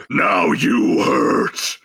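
slow, low brute threat; barbarian. Acted.

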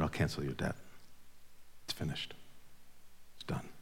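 hushed forgiveness. Acted. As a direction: breathe out the pardon softly, almost a whisper. Master's merciful words, climax of sermon.

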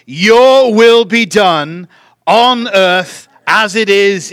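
loud, drawn-out Lord's Prayer recitation; slow congregational prayer. Real, not acted. Preacher leading the congregation in the Lord's Prayer, slow and loud. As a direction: lead the room phrase by phrase, big and measured. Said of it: "I'll say it slowly".

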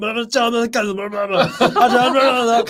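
mock blah-blah babble, high and fast. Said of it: "讲了一大串".

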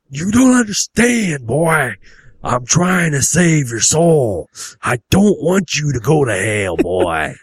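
loud, slow preacher's wail; drawn-out backwoods hellfire shouting; fervent hillbilly dad. Acted. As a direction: plead for his soul at full force.

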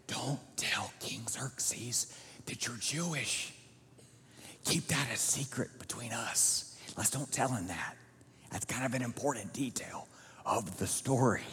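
hushed conspiratorial whisper; whispering a secret to Esther; secretive whisper. Acted. Mordecai's secret advice to Esther.

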